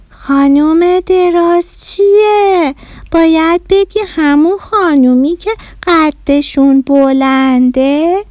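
high, slow, drawn-out reproach; indignant correction. Acted. Neshat correcting Bahram's rude name for mum, indignant. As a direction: stretch each word out to correct him.

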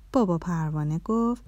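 usual speaking voice

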